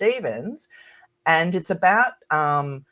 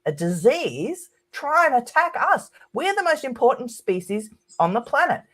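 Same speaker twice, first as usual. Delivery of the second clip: outraged humans; 8 semitones higher than usual. Retold or imitated. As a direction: sneer it like an offended superior species. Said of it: "They're like".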